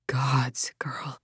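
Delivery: low, hushed gasp of shock; shocked older woman. A narrator's reading. Darina stunned at Lydia's face, hushed and low.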